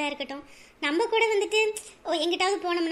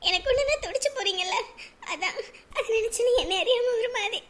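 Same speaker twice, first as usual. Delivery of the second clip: higher, tearful voice; 5 semitones higher than usual. Acted. Wife to caring husband after he asks what happened, tearful. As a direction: speak through welling tears, moved by his love. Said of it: "ஏன் அலற?".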